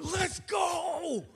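high, drawn-out mock shout, held back in volume; David as yelling fan. Preacher recasting David's psalm as a fan's cheer, mock yell. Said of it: "I want you to see David going".

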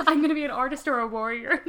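mock Cortez line, fast, broken up by laughter; laughing quote. Joking about Cortez's priorities, laughing through the quote.

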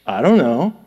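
low "I don't know" after a huff; exasperated. Shown as a demonstration. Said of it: "even though they don't put the".

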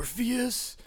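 lightly voiced, mock-clueless Neo; dopey Neo impression. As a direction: play him as a blank, dim hero. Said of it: "He's just like".

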